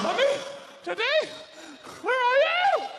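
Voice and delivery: put-on very high, loud child's cry; scared child calling. Children calling for parents in pitch dark, very high. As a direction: wail like a lost child.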